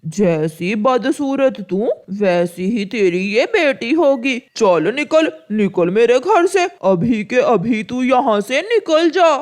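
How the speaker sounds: mildly angry, scornful crow husband. Acted. Kalu throws his wife out of the house, scornful and angry. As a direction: order her out with contempt.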